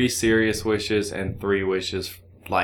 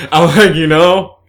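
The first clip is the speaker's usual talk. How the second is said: high, loud laughing talk; 6 semitones higher, 11 dB louder than usual. Real, not acted. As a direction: laugh through the words. Laughing at own Spider-Man obsession.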